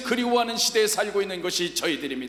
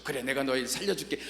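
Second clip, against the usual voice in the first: a lightly voiced God, lower and softer; 7 semitones lower, 6 dB quieter than usual. God answering the hanging man, low and soft. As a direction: speak as God, calm and low.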